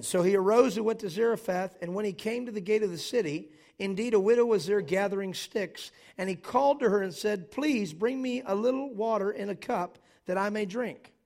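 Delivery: loud, emphatic scripture preaching. Acted. Pastor preaching to the congregation, loud and emphatic. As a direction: proclaim the text with full force.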